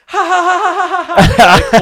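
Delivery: loud, high, hammed-up mocking laughter; exaggerated mocking laugh. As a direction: cackle it out, gloating over-the-top. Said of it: "like, over-exaggerated".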